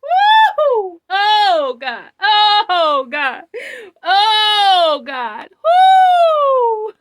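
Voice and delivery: loud, high sobbing wails; crying out to God. Real, not acted. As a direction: cry out in overwhelmed worship, weeping. Overwhelmed reliving the prophecy.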